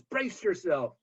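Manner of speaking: mock yell. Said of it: "he yells".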